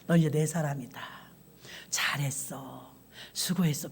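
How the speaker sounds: soft, slow comforting whisper; tender whisper. Acted. God whispering his praise to Moses at the end. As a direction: hush it like a parent praising a tired child.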